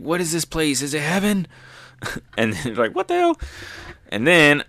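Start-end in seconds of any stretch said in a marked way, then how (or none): 0.0-1.5 higher-pitched, lightly acted amazement; awed wonder. Reviewer voicing Sanji surrounded by women, higher awed wonder. 2.9-3.4 very high-pitched acted bewilderment; startled disbelief. Reviewer voicing Sanji's reaction, high startled disbelief.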